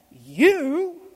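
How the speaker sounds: scoffing giant. Acted. Goliath scoffs at small boy.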